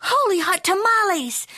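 high, bright kitten cry of surprise; excited exclamation. Acted. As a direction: fling it out with glee. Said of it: "exclaimed Skippy John Jones".

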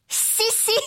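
high, eager little pup voice; Skipito. A narrator's reading. Pup answers French teacher, high little voice. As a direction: pipe up high in Spanish. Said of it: "said Skipito".

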